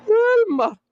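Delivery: very high squealed name; shrill laughing cry of a name. Real, not acted.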